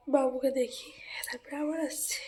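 soft whispered sign-off. Real, not acted. Baby nearby, signing off softly.

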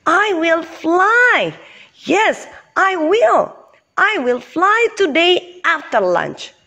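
eager buffalo making a proud promise; brightly. Acted. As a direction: insist cheerfully, pitched well up. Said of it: "said Bucky Buffalo brightly".

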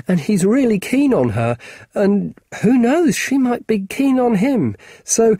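put-on high, quick boy's voice, nervous and rambling; a flustered kid talking too fast; young boy Marcus. Boy nervously explaining to Ally, high and rambling.

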